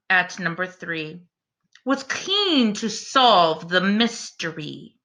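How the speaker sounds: slow, lilting bedtime rhyme reading; story narration. A narrator's reading. Reading a rhyming bedtime book to the class, gentle storyteller voice. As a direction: read it like a picture book to little ones.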